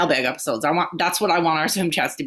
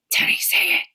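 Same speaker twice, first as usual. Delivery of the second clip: whispering. Pretend. Prompting the whispered chant.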